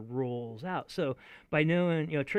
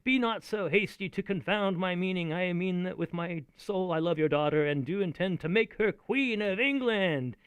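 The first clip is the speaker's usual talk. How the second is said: louder, heightened reading of the line; playful performed Richard line; Shakespeare recital; 6 semitones higher, 5 dB louder than usual. Acted. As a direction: declaim it with a cheeky lilt. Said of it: "Oh, I gave it away".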